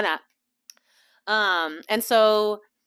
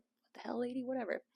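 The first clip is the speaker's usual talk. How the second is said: very soft, under the breath; a hushed private grumble; muttered aside; 3 semitones higher, 15 dB quieter than usual. Retold or imitated. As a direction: say it so she can't hear. Private aside about the lady.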